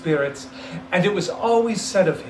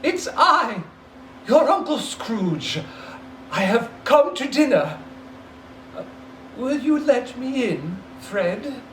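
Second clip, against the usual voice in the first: timid; 6 semitones higher than usual. Acted. Scrooge timidly at the door.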